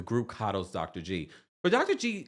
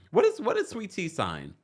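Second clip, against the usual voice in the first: usual speaking voice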